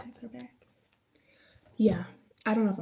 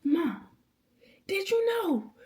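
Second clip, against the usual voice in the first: much higher, eager call; bursting to tell mom a discovery; excited daughter telling mom news; 10 semitones higher, much slower than usual. Retold or imitated. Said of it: "I be like".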